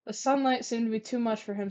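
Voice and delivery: ordinary delivery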